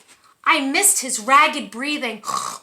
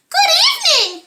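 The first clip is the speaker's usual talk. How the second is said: much higher and louder, silky-voiced monster; a dainty monster greeting, pitched way up; 9 dB louder than usual. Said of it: "he said in a high, silky voice".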